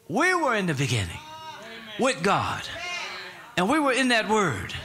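fervent Pentecostal preaching; full-force preaching. Real, not acted. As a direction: build the sermon to its climax. Preaching climax to congregation.

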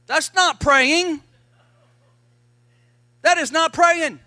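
loud preacher's correction; emphatic rebuke. Real, not acted. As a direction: preach it hard, punch not. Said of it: "That's complaining and murmuring".

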